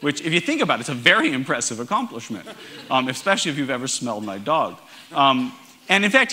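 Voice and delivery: ordinary talk, nothing marked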